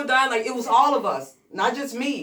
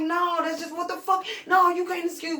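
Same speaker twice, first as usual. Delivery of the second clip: high, loud heated protest; 13 semitones higher, 5 dB louder than usual. Retold or imitated. Writer arguing back at Buck over his notebook, high heated protest. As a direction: push back hard, defensive.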